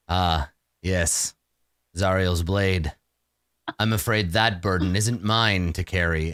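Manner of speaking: low, grave vampire lord; brooding vampire. Acted. DM voices a weary vampire to the party, low and grave. Vampire answers about sword.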